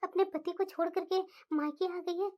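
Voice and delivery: low, calm mother voice; mother calming her angry daughter, low and gentle; soothing mother. Acted. Mother lamenting.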